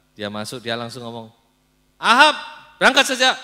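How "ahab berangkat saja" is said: careless mockery. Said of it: "sambil sembarangan".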